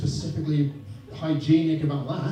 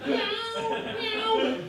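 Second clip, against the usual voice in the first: high mock meow, much higher; cat meowing; 3 dB quieter than usual. Comic imitating the nagging cat, high mock meow. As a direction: whine it up high like a hungry cat.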